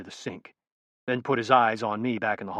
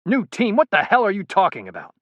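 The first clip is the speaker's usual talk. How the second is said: higher, louder disbelieving outburst; incredulous; 9 semitones higher, 6 dB louder than usual. A narrator's reading. Colonel hears of Pike's new team, incredulous and loud. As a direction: caught off guard and angry. Commander hears Pike defy orders.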